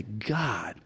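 a quiet desperate cry for help; soft plea to God. Retold or imitated. Pastor voicing his fasting prayer, soft and pleading. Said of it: "and say".

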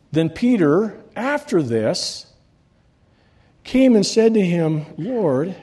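lifted pulpit reading voice; scripture reading. Acted. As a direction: proclaim the passage to the church. Reading scripture aloud to congregation.

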